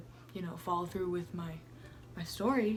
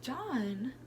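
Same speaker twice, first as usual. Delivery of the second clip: shocked gasp; 8 semitones higher, much slower than usual. Retold or imitated. Shocked at John's confession.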